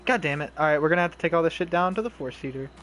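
ordinary delivery

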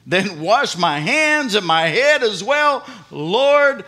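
loud, high eager plea; exclaiming. Retold or imitated. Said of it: "Simon Peter exclaimed".

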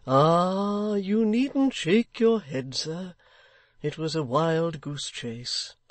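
the kindly locksmith softening bad news. Locksmith consoles Haredale.

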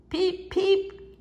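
high whistle-like peep peep; cheerful high train whistle; engine whistle peep. Acted. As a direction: toot it like a little engine's whistle. Thomas whistling to people.

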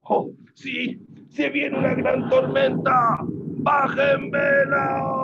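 high, loud storm warning; drawn-out high yell to the crew; alarmed captain's shout. Acted. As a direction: shout orders over a coming storm. Hunter orders crew before storm.

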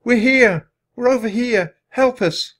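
high, loud shouts for help; calling out. A narrator's reading. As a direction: call across the dark to rescuers. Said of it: "She called out".

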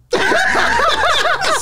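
high, loud burst of laughter. Real, not acted. Angela asks if that's his son.

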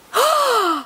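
high, sharp awed gasp; breathless thrilled gasp. Real, not acted. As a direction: gasp at a rare pull. Rare card revealed.

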